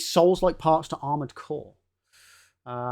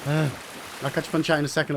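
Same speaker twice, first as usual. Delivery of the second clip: ordinary delivery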